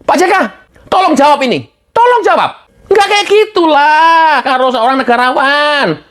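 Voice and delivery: high pleading demand. Real, not acted. Host demanding an answer from JK, high insistent. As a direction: press him for an answer.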